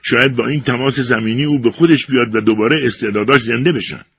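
loud hopeful father's cry; shouting. A narrator's reading. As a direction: order the cowboys urgently. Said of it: "پدر جانی با فریاد گفت".

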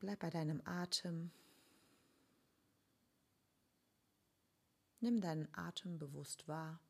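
ruhige, leise Meditationsstimme mit langen Pausen; guided meditation. Acted. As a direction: sanft durch die Meditation führen. Leitet eine Vergebungsmeditation an. (geführte Meditation, leise und ruhig)

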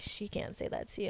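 whispered, unvoiced and soft; hushed whisper. Coworkers quietly backing her up, whispered. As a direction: whisper it like coworkers sharing an outrage. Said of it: "they're like".

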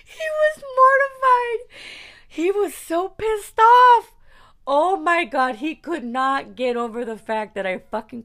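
high, loud, nearly crying with laughter; gleeful squeal, easing down by the end. Real, not acted. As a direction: gasp it out while cracking up. Cracking up over his reaction.

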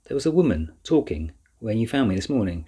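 ordinary delivery, nothing marked

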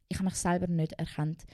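ordinary talk

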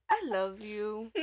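big sigh, slow drawn-out tipsy affection; sigh, then drag it out like a drunk; drunk sister's declaration. Retold or imitated. Drunk sister repeating her love to her helper, slow sighing slur. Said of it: "She was the "I love you" person".

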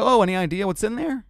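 mock curious. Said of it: "I go".

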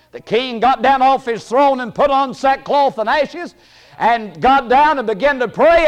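normal talking voice

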